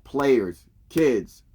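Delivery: ordinary talk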